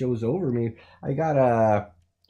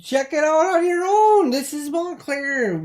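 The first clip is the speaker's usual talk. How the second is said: much higher announcer sign-off; station sign-off; 13 semitones higher, 4 dB louder, slower than usual. Acted. Host signs off the weather to Hector, high announcer voice. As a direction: sign off like local TV.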